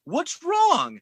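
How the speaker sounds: very high-pitched, clueless question; mock bewilderment. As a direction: squeak it as a baffled man's reaction. Voices the man's bewildered reaction.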